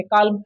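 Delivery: "काल्म" said with the L heard before M; L wrongly sounded. Shown as a demonstration. Teacher repeating the mistake for students, "काल्म" with L sounded. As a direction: voice every letter in "काल्म", L included. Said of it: "मेरा translation गलती हो जाएगा".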